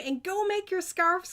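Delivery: usual speaking voice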